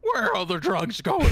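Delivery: very high, loud squeaky mock Batman; parody Batman. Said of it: "the parody Batman voice".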